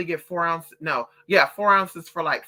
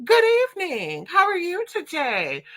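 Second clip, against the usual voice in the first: much higher, sweet customer-service voice; surprisingly polite cashier; 11 semitones higher than usual. Retold or imitated. Cashier greeting her at the door, high and sweetly polite. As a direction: sing it like a cheerful clerk. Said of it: "this man was like".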